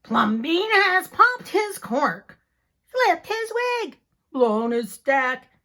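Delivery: loud, slow, scandalized cry; slow, loud outraged gossip; outraged neighbors. Acted. Neighbors scandalized by the rainbow house, loud and slow. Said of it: "The people said".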